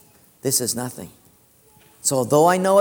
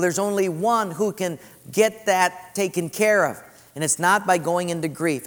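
normal talking voice

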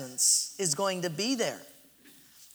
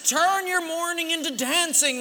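high, ringing exhortation; mock preaching; 7 semitones higher than usual. Husband exhorts mourning wife, mock-biblical proclamation.

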